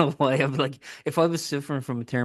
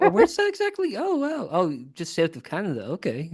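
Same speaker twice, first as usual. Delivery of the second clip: much higher, innocent mock-puzzled voice; feigned ignorance; 7 semitones higher than usual. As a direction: pretend you've never heard of America. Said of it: "act like you've never heard of the country".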